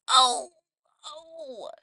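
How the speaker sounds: loud ow, then a high small one; imitated pained "ow". Patient as nausea patch is ripped off, pained cry. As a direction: re-enact a sudden sting. Retold pain as patch rips off.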